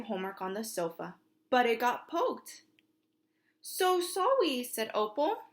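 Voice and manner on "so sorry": put-on toddler apology.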